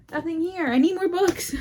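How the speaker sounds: high, bright eager voice; excited. Real, not acted. Excited about empty shelf.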